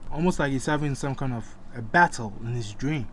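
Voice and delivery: ordinary delivery, nothing marked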